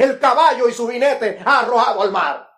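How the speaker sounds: loud, high preaching of the scripture; thundering pulpit reading; fervent preaching. Acted. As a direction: proclaim the verses with fire. Preacher reads Miriam's song loudly.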